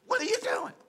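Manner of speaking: high-pitched, comic yelled-email voice; mock yelling. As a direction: shriek it like an angry all-caps email, hammed-up. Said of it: "Stop yelling at me".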